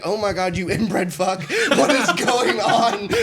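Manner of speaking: high disbelief building to a laughing shout; exasperated outburst. Retold or imitated. Soldier reacting to the Kentucky recruit, exasperated laughing outburst.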